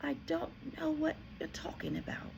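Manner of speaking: put-on soft, high bewildered boy; genuinely baffled; confused boy answers headmistress; puzzled. A narrator's reading. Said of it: "more puzzled than ever".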